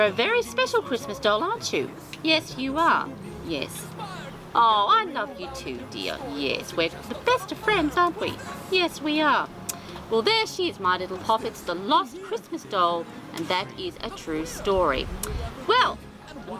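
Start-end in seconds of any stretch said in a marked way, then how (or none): none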